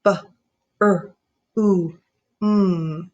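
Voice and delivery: B, r, u, m with a pause between; four sounds of broom apart. Shown as a demonstration. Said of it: "then say it sound by sound".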